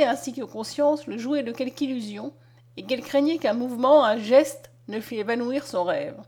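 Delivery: usual speaking voice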